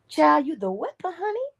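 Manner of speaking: high, sing-song Southern auntie, rising to a squeal on honey; Southern glory voice calling someone child and honey; Southern Dula voice. Retold or imitated. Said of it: "That's how she would say it".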